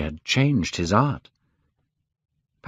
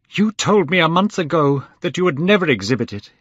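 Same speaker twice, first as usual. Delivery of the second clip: higher and louder desperate reproach; crying out; 8 semitones higher, 4 dB louder than usual. Acted. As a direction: cry it out, panicked. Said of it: "he cried".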